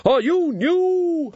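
booming hearty warrior woman; high jolly-hockey-sticks war maiden; Valkyrie voice. Acted. Valkyrie speaks, horned warrior woman.

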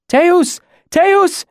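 high, loud shout of a woman calling out. A narrator's reading. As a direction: shout it out high and hard, one word at a time. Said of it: "she shouted".

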